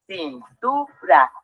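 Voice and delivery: "Cintura" muy lento, cin, tu, ra; separado en sílabas. Shown as a demonstration. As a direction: separa cada sílaba de "Cintura" con una pausa. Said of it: "Vamos a dividir la palabra". ("Cintura" silabeado despacio)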